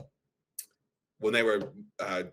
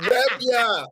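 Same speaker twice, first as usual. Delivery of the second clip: high, louder, with a laugh; mother-in-law grab; 14 semitones higher, 6 dB louder than usual. Retold or imitated. Mimicking the mother-in-law grabbing someone for a hug, laughing. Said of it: "Mother-in-law is like".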